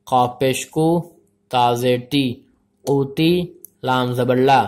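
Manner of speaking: measured, syllable-by-syllable recitation; spelling-drill recitation. Shown as a demonstration. Teacher spells out Arabic letters for learners, measured recitation. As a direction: recite letter by letter, holding the final sounds.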